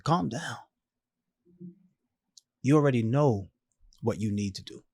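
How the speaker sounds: a lightly voiced dying mom, soothing; calm mother. Dying mom soothes her panicking son, calm and soft. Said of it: "she's calm as heck over there".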